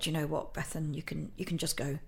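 quiet muttering to herself; hushed self-talk. Retold or imitated. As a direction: talk yourself out of it under your breath. Telling herself to give up.

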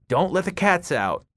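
high-pitched, restrained shout; shouted warning. A narrator's reading. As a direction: shout an urgent order past someone. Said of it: "threw a glance over Bev's shoulder and shouted".

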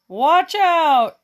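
high, loud alarm caw; cawing crows. Acted. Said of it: "they cawed".

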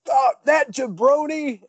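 high, loud, slow gruff wrestler shout; over-the-top wrestling promo voice; Hulk Hogan impression. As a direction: ham up the Hogan catchphrase growl. Hogan voiced in retold video.

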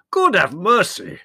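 high, drawn-out wail for mercy; loud, high, desperate plea; loud imploring. A narrator's reading. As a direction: implore heaven at the top of your voice. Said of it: "he implored loudly".

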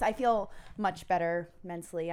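ordinary talk, nothing marked

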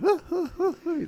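very high-pitched mimicked laughing; imitated laugh. Barber showing how his coworker laughs, high imitation laugh. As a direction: do your coworker's distinctive laugh. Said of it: "the way he laughs".

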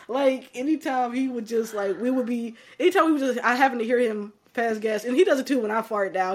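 normal talking voice

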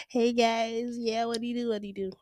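bright, high put-on greeting; fake cheerful. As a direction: act all smiles and chirpy. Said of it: "like, all smiles and be like".

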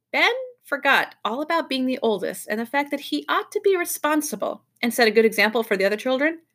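usual speaking voice

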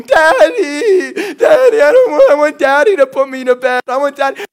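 imitating a little girl crying for her daddy at bedtime; crying child screaming. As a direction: wail for daddy like a sobbing toddler. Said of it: "Octavia screaming".

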